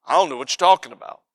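low, quick offhand denial, acted out; playing dumb. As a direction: brush it off as if clueless. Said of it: "he just kinda plays dumb".

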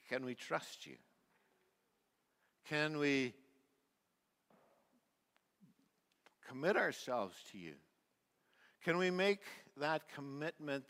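prayer. Acted. Closing prayer.

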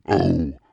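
loud high cry of disgust; disgusted moan. Acted. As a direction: let out a sudden revolted "Oh!". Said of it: "With a moan".

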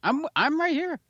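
squeaky high protest; high-pitched protest. Real, not acted. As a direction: pipe up high. Insists he is present.

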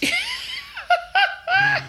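shrieking laugh. Real, not acted. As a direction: burst out laughing high. Big laugh at spoiler.

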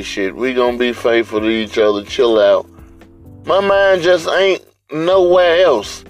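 ordinary delivery

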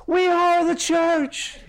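high, slow mock proclamation; retold calling out. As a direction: call it out high, drawn out. Calling answer to boat lady.

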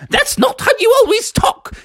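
very high, exasperated nanny; Mary Poppins. Acted. Nanny objecting to Froggy's accent, high and prim.

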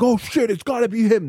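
high, excited cop voice; a cop who just spotted the killer. Retold or imitated. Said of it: "They're like".

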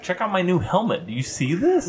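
excited. Acted. Character shows off new helmet.

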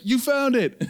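very high, loud imitated cheer; excited exclamation. Man at the showing cheering the found cat, excited and high. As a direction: squeal it like a thrilled onlooker. Bystander reacts to the joyful scream.

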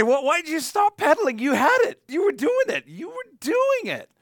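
high, loud mock outrage; a dad telling his kid they were doing it; exasperated dad. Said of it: "you go up to them as a dad and you would say".

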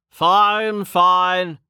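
high, loud give-in; fine, fine, hands up; exasperated. A narrator's reading. Uman gives in, exasperated.

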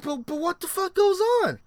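high-pitched, baffled what the; sting victim, baffled, high-pitched disbelief; baffled outburst. Retold or imitated. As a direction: squeak out the confusion at the bee. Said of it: "And I'm like".

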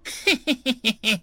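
high rattling villain laugh; a dry, rattling cackle; hoarse dry laugh. A narrator's reading. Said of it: "then laughed, a hoarse, dry rattle".

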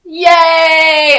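long, loud drawn-out cheer; celebratory cheer. Pretend. Co-host celebrates the new chief's announcement, loud drawn-out cheer.

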